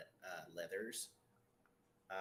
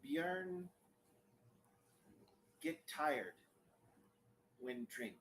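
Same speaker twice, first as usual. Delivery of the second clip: a shy elf struggling with the language; broken-speech elf; 3 semitones higher, slower than usual. Acted. Bjorn speaks broken common tongue.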